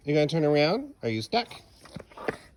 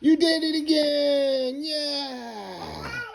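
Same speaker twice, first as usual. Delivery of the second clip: higher, louder, slow delighted shout; overjoyed dad celebrating; thrilled cheer; 11 semitones higher, 3 dB louder, much slower than usual. Real, not acted. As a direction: burst out cheering the shot. Toddler scores again.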